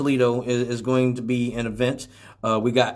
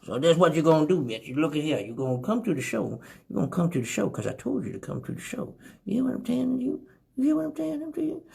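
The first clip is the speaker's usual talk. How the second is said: hushed, hurried menace climbing high; quick, soft bullying impression; 4 semitones higher, 4 dB quieter, faster than usual. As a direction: press softly, pitch rising on the repeats. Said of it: "[name] naming off all your shows".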